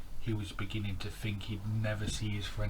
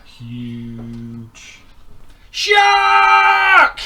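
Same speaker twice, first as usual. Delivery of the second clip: scary reveal; 19 dB louder than usual. Acted.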